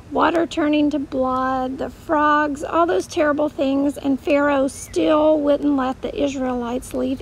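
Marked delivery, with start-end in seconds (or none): none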